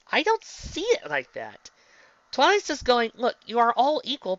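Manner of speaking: usual speaking voice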